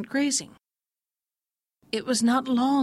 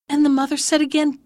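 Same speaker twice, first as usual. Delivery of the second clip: usual speaking voice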